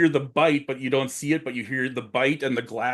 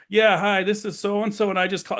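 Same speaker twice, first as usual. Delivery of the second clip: hurried caller; 8 semitones higher than usual. Retold or imitated. Man calling nine one one, hurried and higher. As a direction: rush to explain to the dispatcher.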